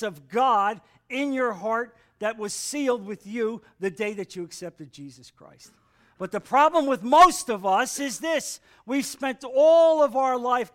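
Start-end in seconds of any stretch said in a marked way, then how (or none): 6.2-10.7 loud, raised preaching voice; emphatic preaching. Real, not acted. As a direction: preach it out forcefully.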